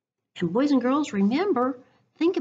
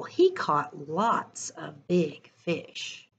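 expressive picture-book read-aloud; reading to children; 3 semitones higher, slower than usual. Acted. Teacher reading a picture book to her class, lively storytime voice.

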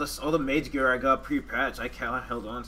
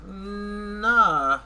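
emphatic nah; 10 semitones higher, 8 dB louder than usual. Real, not acted. Reacting to a dropped item.

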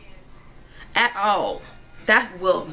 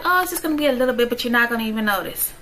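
mocking the doctor; much faster than usual. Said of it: "Oh, he was like".

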